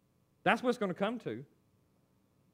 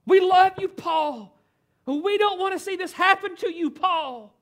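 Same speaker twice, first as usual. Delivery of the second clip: loud, high, desperate pleading; begging and crying; 10 semitones higher, 14 dB louder, slower than usual. Acted. Friends begging Paul not to go to Jerusalem, crying out loud. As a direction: beg him at full voice not to leave. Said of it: "they're begging and they're crying".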